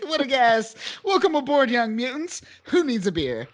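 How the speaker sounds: high-pitched jolly welcome aboard; playful comic-book character voice; hearty Pyro impression. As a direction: hail the youngsters like a merry host. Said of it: "He speaks it in such an Australian accent".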